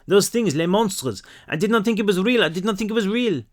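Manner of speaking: put-on high, distressed French-accented girl; frightened French child, pitched high; broken English, French accent. A narrator's reading. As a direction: stumble through French-accented English, pleading. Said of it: "she said in broken English".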